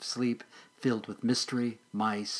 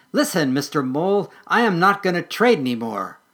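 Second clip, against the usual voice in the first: mock brave squirrel; 5 semitones higher, 7 dB louder than usual. Rehearsing a brave speech.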